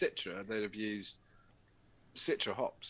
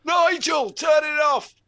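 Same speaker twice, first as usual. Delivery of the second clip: very high, loud shriek of an order; panicked yell; 13 dB louder, slower than usual. Pretend. Boss shrieking at Nigel to shut the machine off. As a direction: scream at Nigel to kill it. Acted boss yelling at worker.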